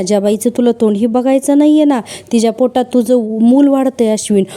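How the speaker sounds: ordinary delivery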